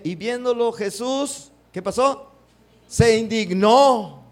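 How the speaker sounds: "Se indignó" drawn out slowly and loud; emphatic reading. Shown as a demonstration. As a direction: hit "Se indignó" hard and slow. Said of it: "reads verse emphatically". ("Se indignó" lento y fuerte)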